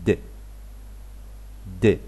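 د said as a short voiced d; just a plain short d; the d sound. Shown as a demonstration. Teacher showing the d ending to learners. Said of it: "بالصوت بتاعها الطبيعي".

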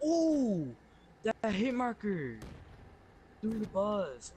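high, excited hype; hyped gamer bro. Real, not acted. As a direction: hype up the hit marker. Hit shot in game.

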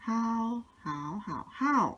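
ฮาว in four tones: level, rising, dipping, falling; four-tone drill. Shown as a demonstration. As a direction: run ฮาว through the Mandarin tones in order. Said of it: "อันนี้อ่านว่า". (ไล่เสียง ฮาว ครบสี่เสียง เรียบ ขึ้น ต่ำ ตก)

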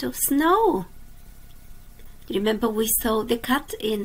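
lively storybook reading for little ones; reading to children. A narrator's reading. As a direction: read it like a bedtime picture book. Reads a picture book aloud.

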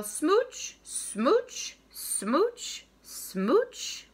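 each smooch swooping up high; playful kissing refrain. Acted. Kiss-kiss fish spreads cheery cheeries, playful rising smooches. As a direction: pop out light, rising smooches with gaps between.

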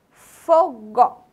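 "Fogo" loud, split into fo and go; syllable by syllable. Shown as a demonstration. Teacher showing children the first syllable, syllable by syllable. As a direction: sound out "Fogo" in two separate syllables. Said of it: "fogo começa com fo".

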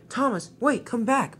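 high, pleading call; calling after someone. Acted. Spencer begs Thomas to come back, calling out. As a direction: call out urgently to stop them leaving.

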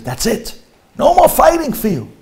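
wife putting her foot down; wife scolding. Retold or imitated. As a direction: snap at him like a fed-up wife.

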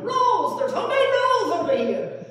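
excited yelling. Retold or imitated. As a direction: shout the find across the street. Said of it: "they were yelling".

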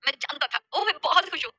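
happy, rising in pitch; filled with joy. Acted. Shaurya learns Chandrika loves him, overjoyed. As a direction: let delight lift the pitch. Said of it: "शौर्य खुशी से भरकर बोला".

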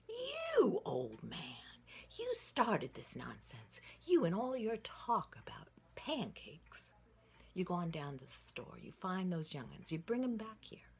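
put-on loud scolding old lady; a mild huffy order; scolding wife. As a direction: send the old man off in a huff.

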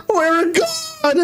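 high squeaky laugh through the words. Real, not acted. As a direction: cackle it out in a tiny high voice.

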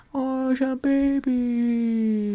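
very high, drawn-out squeal; silly cooing. Pretend. Player spotting a baby in the game, high cooing. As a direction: stretch it out high and slow.

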